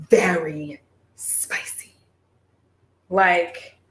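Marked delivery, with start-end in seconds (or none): 0.1-1.9 slow breathy whisper of very spicy. Shown as a demonstration. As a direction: whisper it, drawn out and secret.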